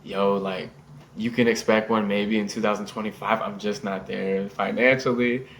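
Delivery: low, soft deadpan; trying to be serious. Retold or imitated. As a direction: retell a straight-faced bluff, quietly. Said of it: "I was trying to be serious".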